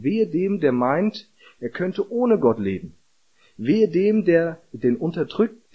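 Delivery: hoch und laut gepredigte Weherufe, jedes Wehe steigend; predigen mit voller Kraft. Acted. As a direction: ruf das Wehe wie ein Prophet. (leidenschaftliche Predigt über die Weherufe, hoch und laut)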